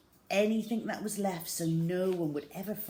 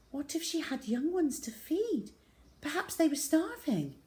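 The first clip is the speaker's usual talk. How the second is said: much higher, worried plea; anxious mother; 10 semitones higher than usual. Acted. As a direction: plead for the cubs. Mother protesting the killing.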